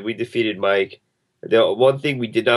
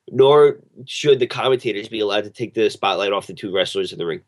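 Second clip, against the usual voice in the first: ordinary delivery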